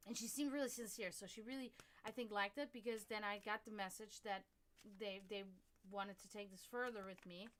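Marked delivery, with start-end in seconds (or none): none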